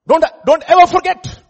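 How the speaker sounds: high, loud preacher's warning; urgent exhortation. Real, not acted. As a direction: drive it home at full voice.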